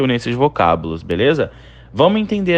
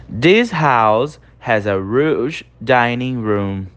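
slow model reading; 2 semitones lower, much slower than usual. Shown as a demonstration. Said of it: "Essa casa tem uma sala de jantar enorme".

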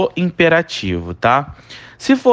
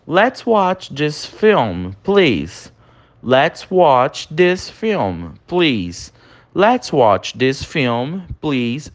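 slow model reading; much slower than usual. Shown as a demonstration. Teacher models English example slowly.

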